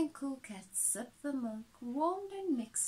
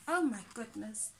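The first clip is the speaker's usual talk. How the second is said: plain reading voice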